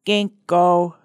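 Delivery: loud, pinched crank-caller announcement; loud whiny prank-call voice. A narrator's reading. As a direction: pinch it and push it out loudly. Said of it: "in a whiny, pinched voice".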